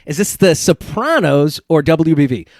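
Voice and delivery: ordinary talk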